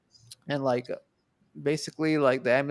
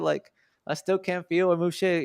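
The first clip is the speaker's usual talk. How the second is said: ordinary talk, nothing marked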